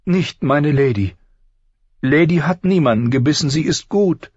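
put-on high, anxious girl's voice climbing; distressed pleading. A narrator's reading. Sansa defending her wolf to her father, distressed.

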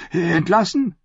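high, disbelieving question; shocked echo. A narrator's reading. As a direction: repeat the word, stunned. Knight echoes in shock.

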